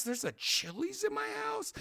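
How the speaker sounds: very high, soft squeak of disbelief; incredulous. Retold or imitated. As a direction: squeak it in stunned wonder. Finds a Chili's in house.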